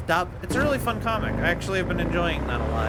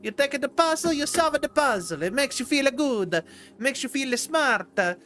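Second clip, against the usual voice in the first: higher-pitched hammed-up Italian accent; bad Italian accent; 7 semitones higher than usual. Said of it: "terrible impression of an Italian accent".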